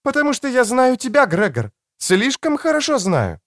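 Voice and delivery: high-pitched voice for a woman; woman's voice. A narrator's reading. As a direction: pitch it up for the female character. Narrator voices the woman character.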